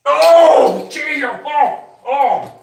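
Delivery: very high, very loud cry of disgust; real disgusted yelling; disgusted shout. Real, not acted. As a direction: recoil loudly from a foul smell. Smelling the stored fart.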